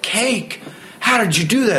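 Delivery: high, squeaky mock astonishment; amazed disbelief. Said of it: "everybody was like".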